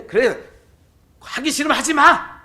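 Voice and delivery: put-on high, loud shout at the child; angry mother shout. A fed-up mother shouting at her child, high and loud. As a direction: yell it like a fed-up mom. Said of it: "하는 엄마의 이야기는".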